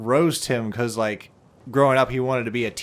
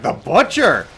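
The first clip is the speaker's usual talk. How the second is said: high, loud retold exclamation; mock outburst; 8 semitones higher, 7 dB louder, slower than usual. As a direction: blurt it out as a triumphant rival pick. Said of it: "And he's like".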